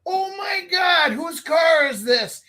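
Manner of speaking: high, slow alarmed shout; half-shouted teenage alarm; shocked teen. Retold or imitated. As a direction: shout it as the truth dawns on you. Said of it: "And you're like".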